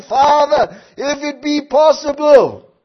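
loud, slow, pleading cry to the Father; anguished cry. Acted. Jesus in Gethsemane crying out to his father, anguished loud plea. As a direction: cry out in anguish, drawing each word out. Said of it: "he's crying out to his father".

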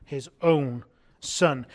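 "own" drawn out slowly, with weight; stress on "own". Shown as a demonstration. Preacher showing the congregation the verse's emphasis, stress on "own". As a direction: lean hard and slow on "own". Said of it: "The emphasis here is on own".

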